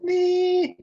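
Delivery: put-on very high, tiny baby voice. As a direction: squeak it out like a little baby. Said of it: "I love that you did it in the baby voice".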